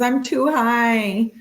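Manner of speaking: high, very slow stoned drawl. Retold or imitated. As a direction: stretch it out like you're baked. Third time, drawn-out stoned voice.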